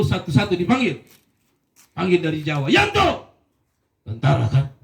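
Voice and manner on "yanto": calling out. Retold or imitated. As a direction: bark the name down the line. Commander calls out recruit.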